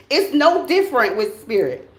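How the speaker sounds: ordinary talk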